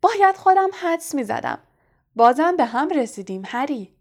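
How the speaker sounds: high, loud, jovial greeting; cheerful shout. A narrator's reading. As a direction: exclaim delightedly, showing off to onlookers. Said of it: "با خوشرویی فریاد زد". (با خوشرویی و فریاد)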